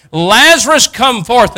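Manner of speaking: high, commanding call; loud cry. Acted. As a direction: cry it out with authority. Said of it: "Jesus cries with a loud, loud voice".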